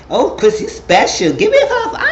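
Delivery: high, loud mock-excited voice; mom's joking gush. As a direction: tease him with hammed-up, high-pitched praise. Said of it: "the mom made a joke".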